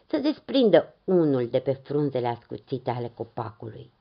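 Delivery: usual speaking voice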